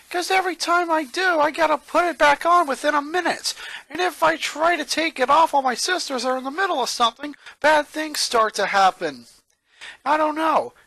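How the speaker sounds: lightly voiced worn-out boy; exasperated boy. A narrator's reading. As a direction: rattle off the trouble. Lincoln complains about sisters.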